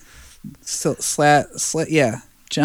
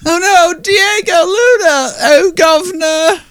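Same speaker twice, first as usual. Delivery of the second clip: put-on much higher, louder, slow crying cockney accent; wailing cockney accent; 6 dB louder than usual. Mocking the actress's accent while wailing in cockney. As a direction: wail it in over-the-top cockney. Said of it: "She's like".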